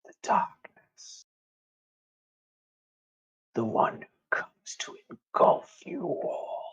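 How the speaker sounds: very slow, menacing hush with long pauses; eerie creature whisper. Acted. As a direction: whisper it like darkness speaking. Creature answers who it is.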